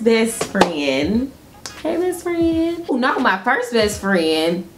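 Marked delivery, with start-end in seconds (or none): none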